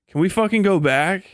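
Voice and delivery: ordinary talk, nothing marked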